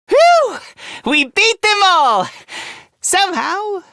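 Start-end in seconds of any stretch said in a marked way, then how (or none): 0.1-0.6 high, loud "whew" of relief; relieved whew. Acted. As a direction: let out a big, high whew. Relief after a fight.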